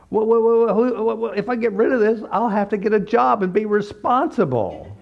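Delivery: mock client pleading not to give up her illness; whinier client. Client panics to the therapist about needing a job, exaggerated whine.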